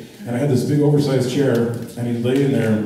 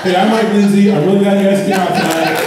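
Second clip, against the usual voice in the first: louder, higher hyped host greeting; show host intro; 7 semitones higher, 5 dB louder than usual. Acted. Host welcomes the audience, pumped up. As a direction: open the show to the crowd. Announcer-style show opener.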